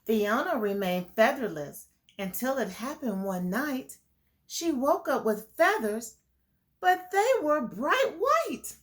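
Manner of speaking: put-on reading a picture book to kids; lilting storybook read; story narration. A narrator's reading. Teacher reading the flamingo story to the class, lilting. Reads rhyming story aloud.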